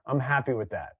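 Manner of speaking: ordinary delivery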